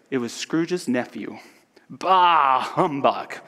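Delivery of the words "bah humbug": loud, dismissive bah; Scrooge. Acted. Scrooge answering his nephew's Christmas greeting, scornful. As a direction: scoff it out with a big first word. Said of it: "said Scrooge".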